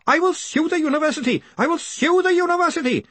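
very high-pitched scream; screamed threat. A narrator's reading. Greenyham threatens to sue the university, high outraged scream. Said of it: "screamed Greenyham".

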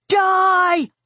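high, sustained battle scream; high-pitched, furious yell. A narrator's reading. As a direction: scream it as a killing command. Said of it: "I screamed".

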